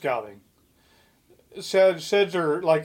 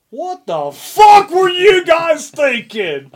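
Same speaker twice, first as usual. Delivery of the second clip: much higher, much louder slow bellow; imitating a raging coach who's had it; furious coach yell; 12 semitones higher, 13 dB louder, slower than usual. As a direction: tear into the players as the angry coach. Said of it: "fucking laid into us".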